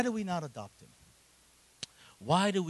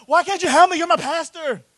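put-on high, louder pleading young man; young men whining; 14 dB louder than usual. Young men complaining to their pastor, high pleading mimicry. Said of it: "They talk like that".

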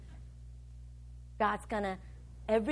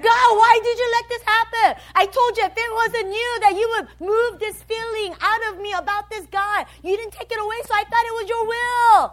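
loud, high-pitched mock wailing; hammed-up aggrieved protest; complaining to God; 10 semitones higher, 9 dB louder than usual. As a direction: whine loudly at God like someone badly let down. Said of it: "you're like that with God".

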